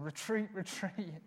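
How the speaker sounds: imitated warning voice, slow and drawn out; Sarah. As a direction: draw it out like a nagging voice in the head. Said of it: "I've got Sarah's voice in my head saying".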